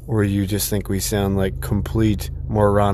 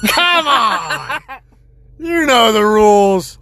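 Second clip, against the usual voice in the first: mock scolding; 11 semitones higher, 5 dB louder, slower than usual.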